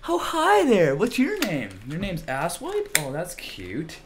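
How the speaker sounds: high sugary sarcastic greeting; high fake-friendly sneer; mock sweet voice. Real, not acted. Mock sweet talk to game.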